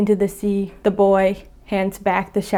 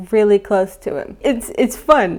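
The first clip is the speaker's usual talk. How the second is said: normal talking voice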